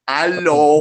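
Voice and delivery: Quran recited in a mocking Persian accent; mock Persian recitation. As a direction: draw it out in an exaggerated Persian accent. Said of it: "how you zanadiqa read the Quran".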